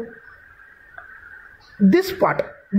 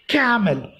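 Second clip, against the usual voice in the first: camel as CAM-el, first syllable stressed. Shown as a demonstration. Teacher showing students the correct word, camel stressed on CAM-. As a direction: hit CA in "camel", let -mel drop. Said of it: "what do you want to say".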